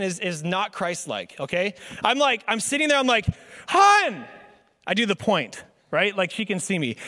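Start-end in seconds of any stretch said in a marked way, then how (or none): 3.7-4.2 sharp, high, loud "Hun!" called across the house; mock-irritated shout at the wife; annoyed call. Pastor calling wife from couch.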